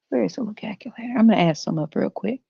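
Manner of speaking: fast whisper while searching; whispering to self. Real, not acted. Comedian hunting for the calculator, whispering to himself. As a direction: whisper it to yourself. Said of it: "searching for calculator".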